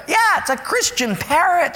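put-on proud little old lady; lightly voiced church lady. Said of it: "she said".

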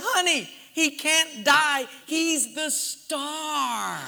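high, slow mock-grand declaration; emphatic reassurance. Husband lets crying wife in on secret, hammed-up insistence. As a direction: stretch the last word out dramatically.

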